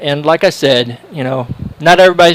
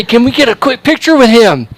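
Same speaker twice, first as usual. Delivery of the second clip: eager tourist ask; 8 semitones higher, 4 dB louder than usual. Retold or imitated. Tourists eagerly asking for photo.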